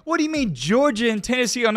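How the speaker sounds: high, mocking fan voice; lightly voiced skeptical fan; imitating doubting viewers. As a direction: needle the host like a heckler. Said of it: "I know what all you sitting there saying".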